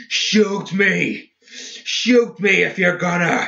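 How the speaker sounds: high, strained, crazed bellow. A narrator's reading. Seamus daring Samael to fire, bellowing. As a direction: bellow it as a wild dare. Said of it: "he bellowed".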